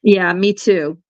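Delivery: ordinary talk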